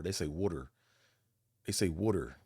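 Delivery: "water" said as "wooder"; Philly water. Retold or imitated. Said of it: "instead of saying water".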